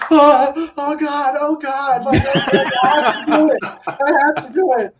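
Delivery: high, slow, breathless gush of disbelief; overwhelmed excitement. Retold or imitated. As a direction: gasp it out like the dream call just came. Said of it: "I was like".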